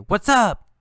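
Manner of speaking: bright, high casual greeting; lively greeting. Shown as a demonstration. Teacher showing a friendly greeting. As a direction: call it out like meeting a friend.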